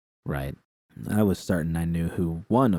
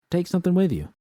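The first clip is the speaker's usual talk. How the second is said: higher, a little louder, pushing it; V's voice; 11 semitones higher, 3 dB louder, faster than usual. Acted. V nudging Judy to bring liquor, higher and insistent. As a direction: tell her to take a bottle.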